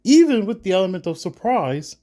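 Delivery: loud, high-pitched protest. A narrator's reading. Reginald objecting to attacking the band, protesting. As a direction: object forcefully, voice jumping up. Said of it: "Reginald protests".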